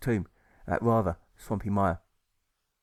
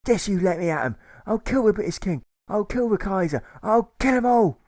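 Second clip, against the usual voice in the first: high, loud, building to a shout; furious threats; 10 semitones higher, 6 dB louder than usual. Acted. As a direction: scream the last kill 'em all. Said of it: "punches his sheets and jumps".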